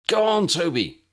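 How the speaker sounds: high, loud urging shout; Harry shouts to Toby to reach the door. A narrator's reading. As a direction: yell him on across the room. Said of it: "he shouted".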